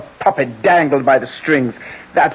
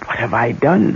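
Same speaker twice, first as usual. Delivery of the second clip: ordinary delivery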